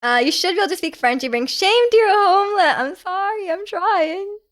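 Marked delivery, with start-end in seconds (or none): 1.2-2.8 high, theatrical mock reproach; hammed-up scolding, raised pitch. Reads a teasing chat message. 2.8-4.4 high, softer mock whine; mock-sheepish apology; playful pleading apology.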